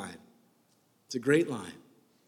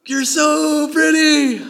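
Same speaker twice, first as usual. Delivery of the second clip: a slow, lightly voiced simple guy; blunt typical man; 7 semitones higher, 4 dB louder, slower than usual. Preacher mocks the husband's lazy reply, slow and simple. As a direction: drag out the lazy compliment. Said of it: "She's all poetic ... he goes".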